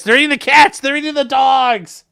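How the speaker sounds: hammed-up alarm, pitched high and loud; loud mock outcry. Streamer quoting a line about pets to chat, loud mock outcry.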